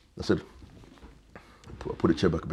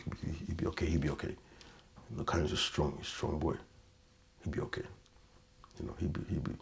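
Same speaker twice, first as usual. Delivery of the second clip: hushed, gentle comfort, much softer; soft reassuring murmur; 11 dB quieter than usual. Retold or imitated. Head teacher soothing a frightened pupil, hushed and gentle. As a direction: soothe him like a worried teacher.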